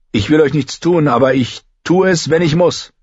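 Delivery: high, tense warning; reluctant threat, pitched high; defiant young John. A narrator's reading. John warns the boys off.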